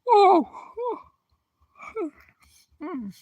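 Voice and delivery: ordinary talk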